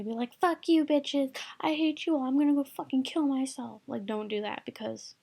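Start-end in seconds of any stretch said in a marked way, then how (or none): 0.4-3.8 acted angry outburst, higher-pitched; mock explosion. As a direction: lash out in an exaggerated tantrum. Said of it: "just explode on everyone".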